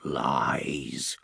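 low, drawn-out whisper. A narrator's reading. Haunted man denying what he senses, whispered. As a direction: breathe the word out without voice. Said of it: "he whispered".

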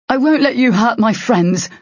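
high-pitched, protective defiance; defiant. A narrator's reading. Derry shielding friends from the blast, defiant.

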